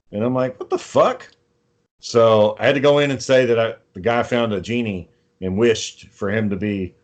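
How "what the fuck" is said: mock disbelief, pitched way up; high-pitched, incredulous retold question; retold baffled reaction. Retells own baffled reaction.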